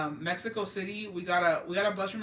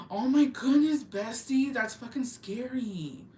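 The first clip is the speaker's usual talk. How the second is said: shocked sympathy; 5 semitones higher, slower than usual. Real, not acted. Shocked sympathy at viewer's story.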